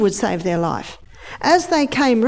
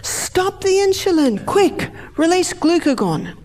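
much higher urgent command; alarmed brain voice; 9 semitones higher than usual. Acted. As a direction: brain barking orders in a panic. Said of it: "the brain says".

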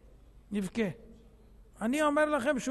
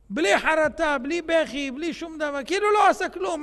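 rising, louder preacher's rebuke; preaching loudly; 3 semitones higher, 6 dB louder than usual. Real, not acted. Preacher rebuking unrepentant worshippers in a loud, rising voice. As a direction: drive the point home with force.

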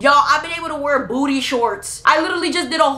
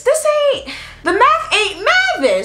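incredulous; 12 semitones higher than usual. Retold or imitated. Niece doubting the airport story, incredulous. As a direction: pitch it way up, baffled.

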